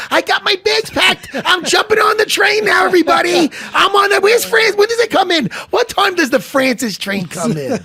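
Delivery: high, loud, frantic shouting; Jimmy Norton. Retold or imitated. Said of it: "little Jimmy Norton's going".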